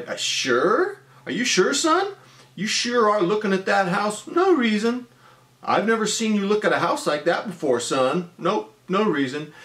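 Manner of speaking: high, mocking dad voice; father ribbing his son about the house; teasing father. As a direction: needle the kid knowingly.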